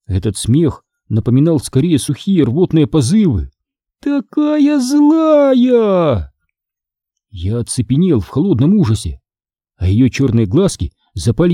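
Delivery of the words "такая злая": very slow, high, drawn-out ghost voice; gloating ghost. Acted. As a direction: stretch each word like a gleeful evil spirit. (злорадный призрак, медленно и высоко)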